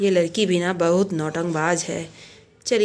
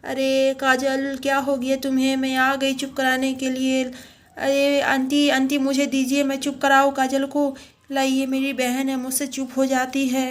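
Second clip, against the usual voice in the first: put-on higher, evenly pitched child voice; helpful little girl; 4 semitones higher than usual. Girl asking auntie to hand over her crying sister, pretend high child voice. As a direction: play a child eager to soothe the baby.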